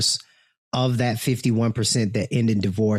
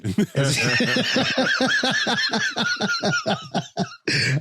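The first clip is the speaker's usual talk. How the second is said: big high laugh. Real, not acted. Big laugh after own joke.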